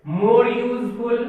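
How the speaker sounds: very slow, word by word; drawn-out teacher dictation. Shown as a demonstration. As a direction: spell it out slowly as you write on the board. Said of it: "हम ये भी कर सकते हैं".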